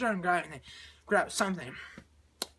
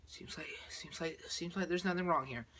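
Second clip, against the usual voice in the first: near-whisper, feeling it out; very soft, wary murmur; hushed caution; 4 semitones lower, 9 dB quieter than usual. Retold or imitated. Astronauts check the ship on return, hushed caution.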